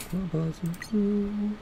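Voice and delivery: a small silly squeak of a question; squeaky high question. Pretend. To teammates, pretending to beg for fuel, squeaky high voice.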